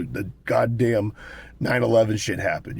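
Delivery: ordinary talk, nothing marked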